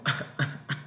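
a short unvoiced cough; demo cough. Shown as a demonstration. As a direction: cough once to show the word. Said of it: "I'm coughing". (tos de demostración)

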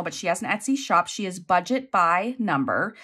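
ordinary talk, nothing marked

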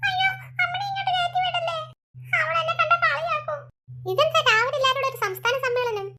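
high tearful pleading; crying. Acted. Shaved girl begging them to keep a neighbour out, tearful. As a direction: plead through acted tears. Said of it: "ഈ കൊച്ചിനി കരച്ചിലും".

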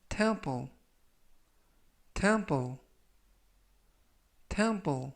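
temple said clearly three times, slow and careful; English pronunciation demo. Shown as a demonstration. Teacher modelling temple for students, careful and clear. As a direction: model the word temple for learners. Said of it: "이라고 발음하시면 되겠고요".

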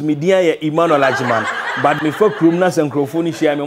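ordinary delivery

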